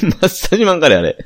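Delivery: laughing. Real, not acted. Joking over the wrong name.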